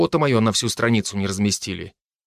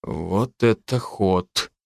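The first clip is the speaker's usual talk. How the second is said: slow, lower, squeezed out in shock; stunned, forced out; 3 semitones lower, much slower than usual. Acted. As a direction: barely get the words out. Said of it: "потом выдавил".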